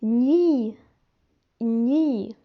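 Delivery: gni said as ny plus a bright i; syllable demo. Shown as a demonstration. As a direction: blend gni clearly. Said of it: "et i se prononce".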